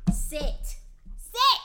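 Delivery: real loud, high-pitched command to a dog; scharfes Hundekommando. Real, not acted. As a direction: shout it at a dog that won't obey.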